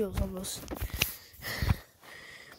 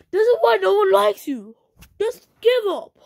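much higher and louder furious yell; angry shout; 8 semitones higher, 9 dB louder than usual. Real, not acted. Kid screaming at the video maker, furious shout. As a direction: scream it at the hater to make him quit. Ranting at a hater, yelling.

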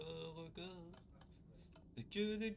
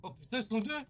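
loud shocked cry; panicking gamer; 6 semitones higher, 6 dB louder, faster than usual. Real, not acted. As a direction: gasp out in alarm at the enemies. Sees two monsters.

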